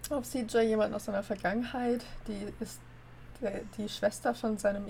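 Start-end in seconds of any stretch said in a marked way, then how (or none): none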